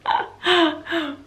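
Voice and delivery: bright high burst of laughter. Real, not acted. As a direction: laugh at yourself, high and light. Laughing at her own sass.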